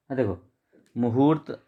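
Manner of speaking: ordinary delivery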